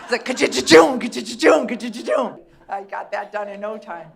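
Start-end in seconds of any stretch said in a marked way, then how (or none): 0.2-2.4 loud mock dryer tumble sound; dryer tumbling noise. Comedian mimicking her phone in the dryer, loud tumbling noise. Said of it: "threw it in the dryer".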